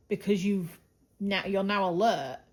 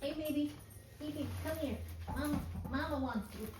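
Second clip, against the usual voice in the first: high, much softer cooing to the puppy; 7 semitones higher, 8 dB quieter than usual. Real, not acted. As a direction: coax the puppy over tenderly. Coaxing the dog over.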